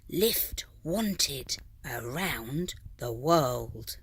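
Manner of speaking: slow whispered message. A narrator's reading. Snail's silvery trail spelling a request, slow whisper. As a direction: breathe it out slowly, unvoiced.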